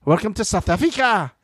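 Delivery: lightly put-on South African accent. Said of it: "do the South African accent".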